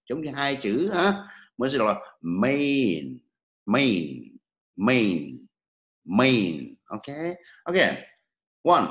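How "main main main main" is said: "main" drawn out as may gliding into in; may plus in. Shown as a demonstration. Teacher shows it is like two words, long gliding may-in. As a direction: stretch "main" like two words run together. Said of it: "May và thêm chữ in nữa".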